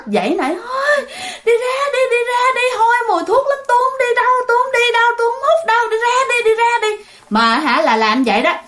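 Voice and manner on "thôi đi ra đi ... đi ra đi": put-on very high, wailing child's tantrum; a possessed girl crying and refusing to budge, much higher. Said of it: "bạn Hiền khóc nha. Bạn Hiền làm giống như một đứa con nít".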